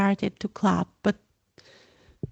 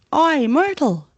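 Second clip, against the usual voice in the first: loud high bellow across the room; 7 semitones higher, 5 dB louder than usual. Acted. Peeves summoning Myrtle, loud bellow. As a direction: call out to her at full voice.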